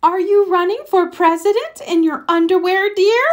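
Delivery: high, teasing question; amused mom. Acted. Mother teases Froggy about his underwear, high and amused. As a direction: gently point out the underwear.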